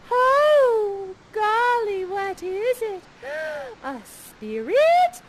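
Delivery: put-on breathless girlish wonder at a stranger; Miranda, awed. Miranda peeking from behind the tree, wide-eyed. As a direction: gasp out the words like a startled girl. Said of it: "you peek outside from behind the tree and you say".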